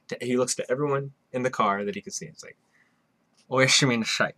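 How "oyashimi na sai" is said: put-on drunkenly slurring the words, a bit higher and louder; slurred drunk voice. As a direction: mumble it like a tipsy salaryman. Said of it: "Very slurred voice".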